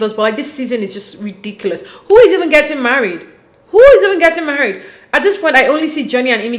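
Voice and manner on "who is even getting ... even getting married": high, loud disbelief; exasperated rhetorical question. Real, not acted. Viewer fed up with a ridiculous season, exasperated. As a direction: throw up the question in exasperation, louder.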